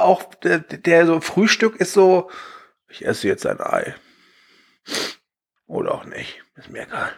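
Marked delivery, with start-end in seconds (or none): 2.9-3.9 mock deadpan voice. Mock voice of the band guy. 5.7-7.1 indifferent whisper. Retold or imitated. Imitating the indifferent guy.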